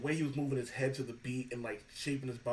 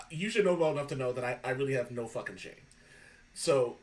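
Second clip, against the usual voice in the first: ordinary talk, nothing marked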